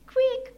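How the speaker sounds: short, very high squeak; mouse squeak. A narrator's reading. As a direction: squeak like a startled little mouse. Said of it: "machte eine kleine Maus".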